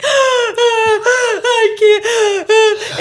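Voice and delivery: high, loud sobbing imitation with a gasp; mock sobbing. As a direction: sob it out, half laughing. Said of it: "I was literally like".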